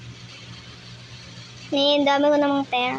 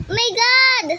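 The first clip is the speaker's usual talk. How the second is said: panicked; 6 semitones higher than usual. Real, not acted. Panic after chase.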